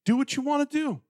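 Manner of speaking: taunting dare. Acted. Believer daring the devil to try, taunting. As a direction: pitch lifted high, defiant.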